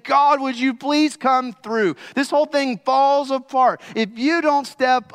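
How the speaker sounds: high, loud pleading cry; pleading disciples. Acted. Disciples in the storm crying out to Jesus, pleading loudly. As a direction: beg God to come through. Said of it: "crying out to him, saying".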